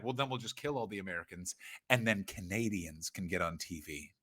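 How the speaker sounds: low, hushed scheming voice; low plotting. Acted. Canadians plotting to get on TV, low and hushed. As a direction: drop low and soft, like a secret plan.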